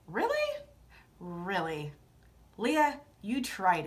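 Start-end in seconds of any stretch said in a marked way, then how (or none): 0.1-0.6 high disbelieving really; shocked, pitched way up; sky-high incredulous question. Real, not acted. Incredulous at the tweet. 1.2-1.9 low, soft unimpressed repeat; deadpan really. Real, not acted.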